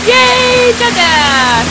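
loud happy cheer for dad. Real, not acted. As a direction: shout yay to dad. Cheering at skier.

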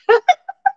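high-pitched burst of laughter; delighted laugh. Real, not acted. Host tickled by a viewer's card, high laugh.

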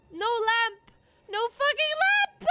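loud, drawn-out lament rising high; loud wail. Real, not acted. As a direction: wail it out in frustration. Wailing about missing lamp.

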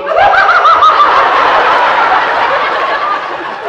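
high shrieking burst of laughter; helpless giggling fit. Retold or imitated. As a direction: shriek with laughter like Eve did. Eve bursts out laughing, retold.

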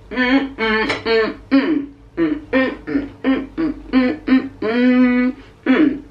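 talking through a mouthful; mumbling around peanut butter; mouth full. Real, not acted. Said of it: "don't speak with your mouth full".

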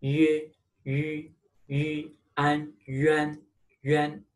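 yuan built from yu and an; pinyin yuan demo. Shown as a demonstration. Teacher shows students how yuan is said. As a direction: blend yu into an: yuan. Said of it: "So it pronounced yuan".

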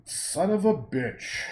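slow gleeful curse; savoured swear. Shown as a demonstration. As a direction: roll the swear out slowly with relish. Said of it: "I love saying that word now".

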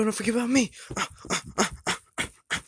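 ordinary delivery

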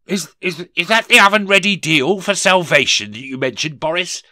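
a lightly voiced shaky miser; faltering voice. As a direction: falter over the words, afraid of the answer. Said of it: "Rishi demanded in a faltering voice".

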